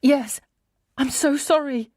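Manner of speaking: high, breaking apology; tearful. Acted. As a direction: plead through tears.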